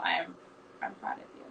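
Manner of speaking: nearly voiceless, very soft, emotion catching the words; breathless, tearful praise; choked-up whisper. Real, not acted. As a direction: whisper it through tears held back. Emotional moment with friend.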